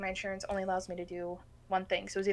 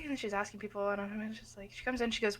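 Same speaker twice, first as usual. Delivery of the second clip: ordinary talk, nothing marked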